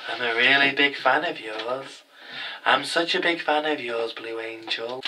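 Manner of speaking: soft, eerie, breathy-close creepy admirer; creepy stalker fan. Retold or imitated. A creepy stranger telling Blue Angel he's her fan, soft and eerie. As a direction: purr it like a sinister G-Man type. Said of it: "Really big creepy eyes".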